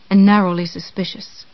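ordinary delivery

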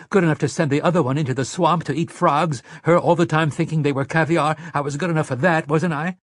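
heated bitter protest; almost spitting. A narrator's reading. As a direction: pour out the grievance, nearly spitting. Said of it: "Mia almost spat at him".

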